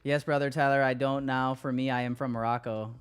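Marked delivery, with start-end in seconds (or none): none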